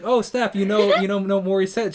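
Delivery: high, loud, impressed outburst; retold amazed excitement. Host retelling his reply to a friend, amazed excitement. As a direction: replay your thrilled surprise, stumbling over the words.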